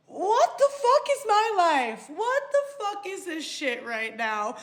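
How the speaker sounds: slow, high-pitched disbelief; incredulous. Retold or imitated. Storyteller reacting to his FaceTime call, incredulous.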